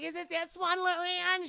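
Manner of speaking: lightly voiced little girl asking. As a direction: ask it like a small girl.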